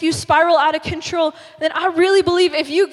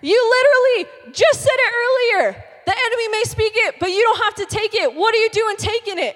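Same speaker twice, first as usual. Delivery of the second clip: high-pitched, incredulous scolding; exasperated Lord; 6 semitones higher, 3 dB louder than usual. Acted. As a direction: tell them off in a raised pitch. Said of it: "And he's like".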